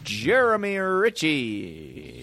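very drawn-out announcement of a name; mock announcer. Sidekick plugging his friend on the show, mock announcer. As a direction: stretch the name out like a ring announcer. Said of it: "I'll mention his name more".

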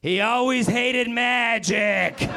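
high, slow, laughing deadpan; deadpan magician. Retold or imitated. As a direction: drag it out like a smug magician. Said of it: "looks at everybody and goes".

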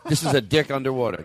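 ordinary talk

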